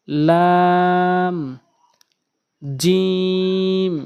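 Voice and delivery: each Arabic letter held long; letters chanted slowly and clearly; drawn-out letter names. Shown as a demonstration. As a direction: recite each letter slowly, syllables stretched.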